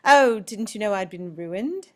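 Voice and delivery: British character voice, near own pitch; second British accent. Acted. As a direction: answer as the other speaker in a British accent. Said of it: "you can see two distinct accents".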